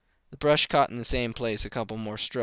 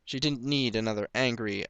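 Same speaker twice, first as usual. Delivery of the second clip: usual speaking voice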